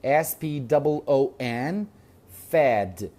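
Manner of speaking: ordinary talk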